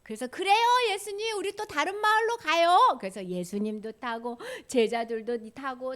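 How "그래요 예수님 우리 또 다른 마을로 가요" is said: eager agreement. Acted. Voices disciples agreeing to go.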